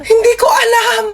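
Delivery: hammed-up sobbing shout; crying shout. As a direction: wail it out in exasperation. Corpse shouting back.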